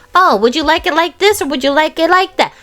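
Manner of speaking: mock polite offer. Imagining an attacker offering choices, mock polite. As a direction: offer choices with exaggerated courtesy.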